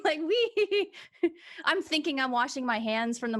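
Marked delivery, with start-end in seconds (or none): 0.2-0.9 high laughing "wee"; gleeful squeal. Pretend. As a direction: squeal with joy while splashing. Splashing happily in water.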